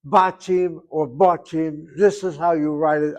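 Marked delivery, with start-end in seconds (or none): 0.1-1.8 first "Batim" with open "ah", second "Batim" with rounded "o"; two pronunciations. Shown as a demonstration. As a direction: contrast "Batim" as bah-TEEM, then baw-TEEM. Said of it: "however you want to pronounce it".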